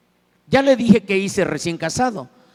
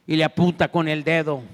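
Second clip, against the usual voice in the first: ordinary talk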